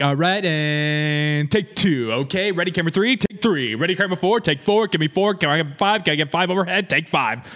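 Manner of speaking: high, rapid-fire director calls; auctioneer. Retold or imitated. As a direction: call the cameras like an auctioneer. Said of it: "You're like an auctioneer back there".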